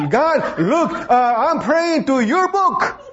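high-pitched kid pretending to pray; boy's mock prayer. Boy shows off to the preacher that he can pray, mock prayer. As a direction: imitate a child praying, drawn out and high. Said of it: "I can also pray".

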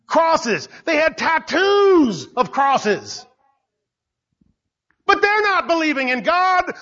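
high, driving sermon delivery; preacher rebuking unbelievers, high and driving; preaching at full force. Acted. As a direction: hammer each question home.

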